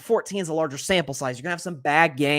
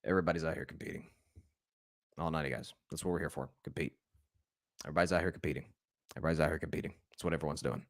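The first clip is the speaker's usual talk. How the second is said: very low, hushed coach's mumble; Bill Belichick impression; 12 semitones lower, 13 dB quieter, much faster than usual. Football coach answering reporters about quarterback competition, low muttered impression. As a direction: drop way down, barely projecting, like a terse coach. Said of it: "do my best, uh, Bill Belichick, uh, impression".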